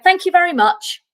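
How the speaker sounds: high, sing-song polite thank you; polite panel brush-off. Retold or imitated. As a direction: mimic the casting panel's chirpy dismissal. Casting panel dismisses her politely.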